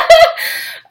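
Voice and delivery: high, loud laugh through tears; teary laugh. Real, not acted. Laughs off almost crying.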